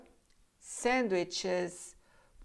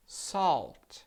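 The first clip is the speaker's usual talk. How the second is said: ordinary talk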